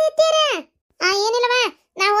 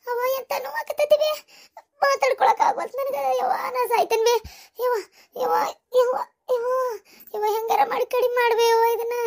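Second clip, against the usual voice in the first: high, tearful moaning; pleading in agony; 5 semitones higher than usual.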